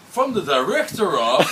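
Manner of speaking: high, slow, laughing mock announcer; Stewie voice. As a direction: squeal it out while laughing. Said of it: "then he said".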